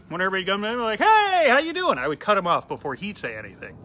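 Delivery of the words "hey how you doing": loud, high, hearty fake cheer; booming fake-friendly hello to a regular; fake buddy greeting. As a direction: overdo the friendly bartender hello.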